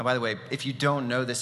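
ordinary talk

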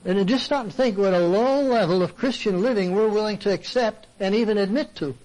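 usual speaking voice

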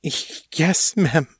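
high, halting, breathed reply; breathed answer. A narrator's reading. Morgan answering her mistress, breathed and halting. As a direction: stammer it out on a breath. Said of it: "Morgan breathed".

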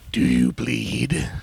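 slow, gravelly growl of a line; gravelly Batman voice. Retold or imitated. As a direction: imitate the gruff Batman rasp. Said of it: "everybody's gotta do the gravelly voice".

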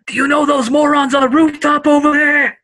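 high, loud whisper yell; whisper shout. Acted. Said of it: "whisper shouting range, I'll be like".

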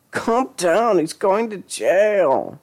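high, slow soothing words; calm her down gently; reassuring. A narrator's reading. Lisa soothing Claudette.

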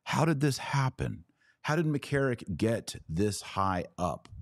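ordinary talk

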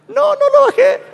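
a high, far-off gasp of panic; shocked realization. Retold or imitated.

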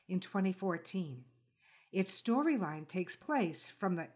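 measured, polished host read of a scripted introduction; calm, reflective announcer voice opening the show; podcast intro read. Acted. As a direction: read it like a composed radio essay, unhurried.

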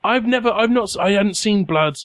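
high, stammering panic; flustered and distressed. Acted. Nervous officer rattled by blood.

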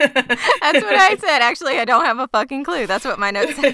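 talking through high, loud laughter; laughing. Real, not acted. Co-host cracking up over the notes, laughing loudly. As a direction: crack up while getting the words out.

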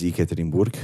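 Yekaterinburg said the Russian way, crisp t and tapped r; Russian pronunciation. Real, not acted. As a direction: say Yekaterinburg with Russian sounds, ending in a hard "burk". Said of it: "Can you say that with an American accent?".

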